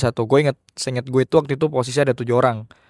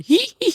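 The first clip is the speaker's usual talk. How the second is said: very high-pitched mock ghost giggle; imitated kuntilanak laugh. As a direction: mimic a movie ghost's high "hihihi" giggle. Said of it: "kayak yang kuntilanaknya di film-film".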